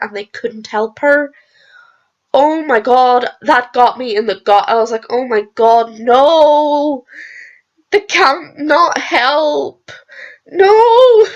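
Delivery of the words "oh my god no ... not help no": anguished outcry. Retold or imitated. Retelling despair at Rosa Parks scene.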